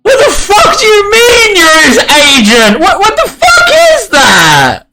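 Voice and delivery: outraged shout. Acted. Guard in the tree yells at the bluffer, outraged. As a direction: scream it in shocked fury from the tree. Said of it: "the one in the tree turns around and goes".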